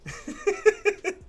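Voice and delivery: high-pitched helpless laugh; high giggle. Real, not acted. Trader laughing at buyers taking his flow, high giggle.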